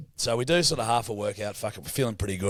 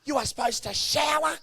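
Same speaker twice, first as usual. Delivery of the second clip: imitating her, much higher and hammed-up; high nagging voice scolding at the ice bath; mocking a bossy woman telling them to shower; mock shrill woman; 11 semitones higher than usual. Woman at gym told in high mock voice.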